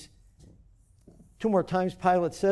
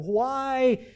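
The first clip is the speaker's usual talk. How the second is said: Pilate's plea; 5 semitones higher, slower than usual. Acted. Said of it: "Then Pilate said to them".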